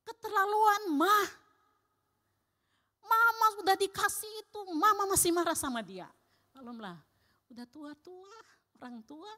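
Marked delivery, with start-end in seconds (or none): 0.0-6.1 put-on child scolding mother. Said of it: "Anaknya sampai bilang".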